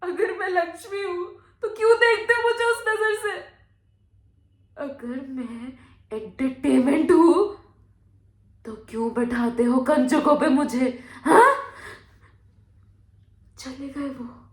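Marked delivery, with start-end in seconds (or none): 0.0-3.3 high, loud, crying challenge; tearful accusation. Acted. 4.8-11.6 angry challenge. Acted. Bitter challenge to society.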